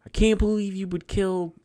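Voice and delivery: high, accusing mock shriek; playing the hysterical wife; wife accusing her husband, high mock fury; mock flip out. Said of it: "she just flips out".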